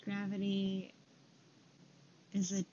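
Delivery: calm them down with a quiet voice; guided meditation. Acted. Leads a breathing meditation.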